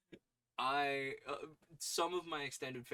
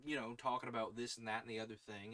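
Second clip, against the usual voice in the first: usual speaking voice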